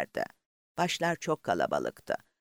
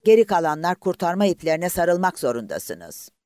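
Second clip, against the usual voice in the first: raised, stern order to the swimmers; loud command; 5 semitones higher, 5 dB louder than usual. Acted. Officer ordering the swimmers to hold the ropes, stern. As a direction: call it out firmly over the water.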